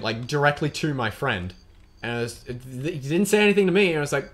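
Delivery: usual speaking voice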